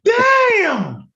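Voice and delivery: high, falling exclamation; astonished outcry. Real, not acted. Finding out he mispronounced it for ten years, astonished cry. Astonished at his long-held mistake.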